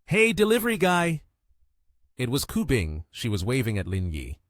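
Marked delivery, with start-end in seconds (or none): 0.1-1.2 high-pitched call for attention. A narrator's reading. Woman hailing the delivery guy from behind, calling out. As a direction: call out high, as if across a beach. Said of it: "heard a voice calling out".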